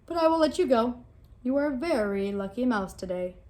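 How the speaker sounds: loud lion voice, generous; booming lion. Acted. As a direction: grant mercy like a king. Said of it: "said the lion".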